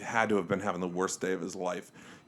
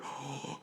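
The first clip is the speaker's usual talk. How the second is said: soft mock gasp of shock; imitated shocked gasp; 15 dB quieter than usual. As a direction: gasp like the stunned wife. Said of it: "his wife was like".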